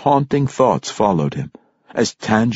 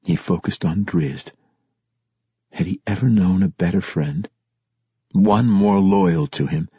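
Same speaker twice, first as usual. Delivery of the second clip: usual speaking voice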